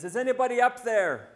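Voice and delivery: loud, high shout up the cliff; calling for help. Acted. Man hanging off a cliff calls up for help, loud and high. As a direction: yell upward, hoping someone hears. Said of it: "looks up above the path and says".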